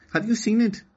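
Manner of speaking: put-on a small girl asking hopefully; high hopeful girl voice; little girl Okani. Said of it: "Okani replied".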